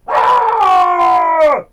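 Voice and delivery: loud, high anguished cry; shout. Acted. Pastry chef discovers his cake is gone, screaming. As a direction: scream out over the lost cake. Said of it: "qu'il entendit un cri".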